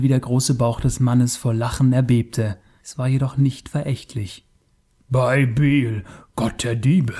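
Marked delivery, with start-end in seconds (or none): 5.1-7.1 hissing. Acted. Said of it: "zischte Taurus".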